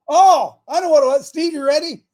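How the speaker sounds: loud, high-pitched calling out; yelling across. Real, not acted. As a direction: holler over to someone across the room. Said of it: "I'm yelling over there".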